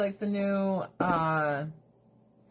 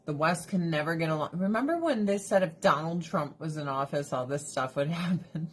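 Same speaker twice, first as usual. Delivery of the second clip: ordinary talk, nothing marked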